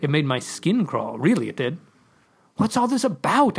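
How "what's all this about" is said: high-pitched indignant demand; urgent, insistent question. A narrator's reading. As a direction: demand an explanation, pitch jumping up. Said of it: "I demanded".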